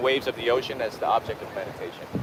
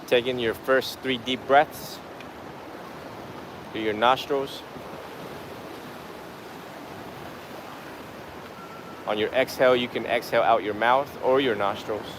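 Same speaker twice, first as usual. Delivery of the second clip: guided meditation; 6 dB quieter than usual. Real, not acted. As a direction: guide the breath unhurriedly, phrase by phrase. Leads a breathing meditation.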